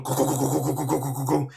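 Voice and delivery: chug like an idling V8; muscle car rumble. Retold or imitated. Imitating a muscle car for friends, rumbling engine. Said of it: "it was just going".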